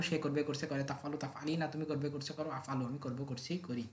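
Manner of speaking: quiet, running rote chant; soft memorisation drill. Acted. Teacher repeating forms quietly for memorising, soft rote chant. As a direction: murmur the forms over and over to learn them.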